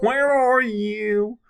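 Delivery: high, slow, whiny nasal singing. Retold or imitated. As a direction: stretch it into a nasal whine. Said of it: "best nasally voice".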